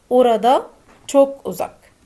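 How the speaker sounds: very slow, carefully spelled out; slow Turkish teaching demo. Shown as a demonstration. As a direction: model each syllable slowly for learners.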